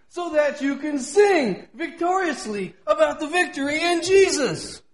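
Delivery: ringing pulpit proclamation; impassioned preaching. Real, not acted. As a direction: preach it high with drawn-out emphasis. Preaches at full force.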